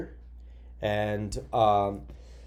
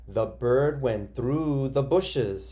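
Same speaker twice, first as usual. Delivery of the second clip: slow example sentence; 2 semitones higher, much slower than usual. Shown as a demonstration. Teacher models an example sentence, slowly. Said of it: "You could say, for example".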